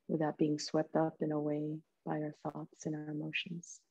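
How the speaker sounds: soft, calm meditation voice with long pauses; guided meditation. Real, not acted. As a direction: gently guide them into stillness, leaving space between phrases.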